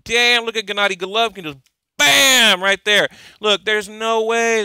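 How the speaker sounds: loud, high, amazed; hyping up the fighter's muscles to viewers, loud; excited shouting. Real, not acted. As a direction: shout at the photo in disbelief. Hyped by a fighter photo.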